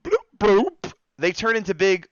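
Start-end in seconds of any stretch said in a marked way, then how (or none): 0.0-0.9 high, louder cartoon bloop noises; mock bloop sounds. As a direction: pop it like a cartoon swelling. Sound of swelling up.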